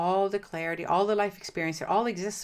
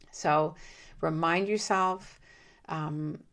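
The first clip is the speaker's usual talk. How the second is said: ordinary talk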